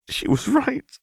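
a lightly voiced choke, pitched high and strained; choked out. A narrator's reading. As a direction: squeeze the words out as if fighting tears. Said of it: "Vi choked out".

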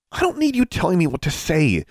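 high-pitched irritated hiss. A narrator's reading. Alexandra telling off a pestering classmate in class, hissing. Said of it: "she hissed at him".

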